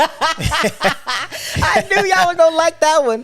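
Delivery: high-pitched, drawn-out words through laughter; real laughing. Real, not acted. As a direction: squeal it out while cracking up. Both crack up at a joke.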